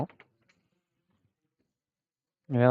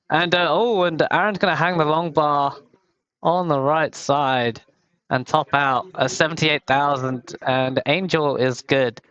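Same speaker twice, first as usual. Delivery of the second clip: excited Tetris commentator calling a top-out; live commentary; 5 semitones higher than usual. Acted. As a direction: call the action as it happens.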